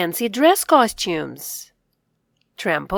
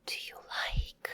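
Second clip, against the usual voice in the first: whisper; 17 dB quieter than usual. Shown as a demonstration.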